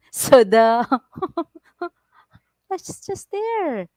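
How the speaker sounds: ordinary delivery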